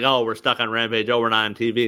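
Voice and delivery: low-pitched mock griping; mock complaining. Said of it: "they've openly bitched about".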